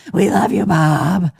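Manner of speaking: mock drag queen call; [name]. Co-host punking a friend with the catchphrase, playful drag voice. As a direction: toss it out like a teasing drag queen. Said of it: "punk each other with that".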